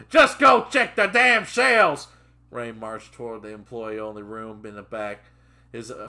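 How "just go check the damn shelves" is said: loud, high, drawn-out order; furious shout. Acted. Trainer cutting off his trainee, furious shout. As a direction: bellow the command word by word.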